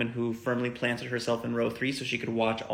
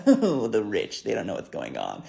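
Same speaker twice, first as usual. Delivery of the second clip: drops much lower, mocking the rich; mock snob; 5 semitones lower, slower than usual. Riders mocking the rich guy, snobbish. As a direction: sneer down your nose at them.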